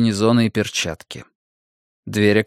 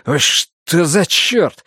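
high, drawn-out yelp of alarm; startled cry; 6 semitones higher, much slower than usual. A narrator's reading. Gunnar stumbling back from a body in the dark, startled. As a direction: recoil in shock, slow and high.

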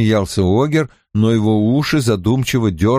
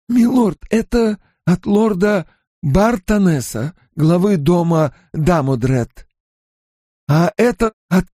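awestruck servant; 9 semitones higher than usual. A narrator's reading. Servant telling his lord who sent the letters, awestruck. Servant awed by lords' seals.

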